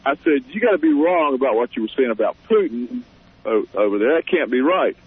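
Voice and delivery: ordinary delivery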